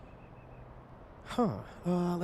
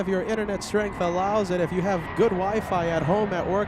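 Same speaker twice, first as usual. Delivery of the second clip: excited; 6 semitones higher, 6 dB louder than usual. Real, not acted. Host pitching to viewers.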